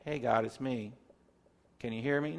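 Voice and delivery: soft, offhand "Hey, God" to a buddy; too casual with God, quiet and light; mock-casual quiet call to God; casual prayer. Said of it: "it's not the time to be".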